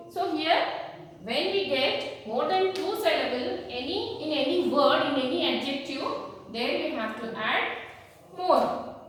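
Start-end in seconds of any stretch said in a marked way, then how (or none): none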